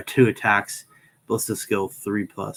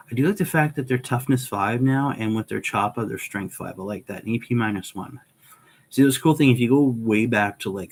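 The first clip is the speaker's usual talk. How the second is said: usual speaking voice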